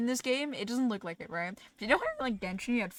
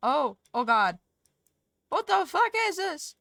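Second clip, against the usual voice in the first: high, loud, drawn-out disbelief; real shock, dragged out; shocked outburst; 6 semitones higher, 8 dB louder, slower than usual. Real, not acted. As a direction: blurt it out, stunned. Shocked at game message.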